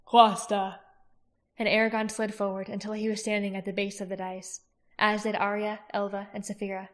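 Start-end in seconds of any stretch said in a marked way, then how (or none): 0.0-0.8 spell command. A narrator's reading. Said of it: "motioned and said".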